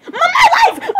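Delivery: high, loud laughing cry of my life; shouted my life. Acted. Drama queen yelling over the other kids, laughing shriek. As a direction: throw the phrase out in a laughing shriek.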